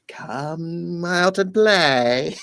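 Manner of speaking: put-on high, loud, drawn-out child's call; sing-song call to play. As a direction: coax a friend outside like a kid at the door. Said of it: "Mikey has to be like".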